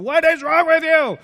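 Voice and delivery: high, loud frustrated shout; exasperated yell. Retold or imitated. Throttling the happy people, shouting in frustration. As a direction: shake them with your voice. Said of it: "grab their throats and go".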